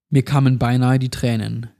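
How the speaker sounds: ordinary delivery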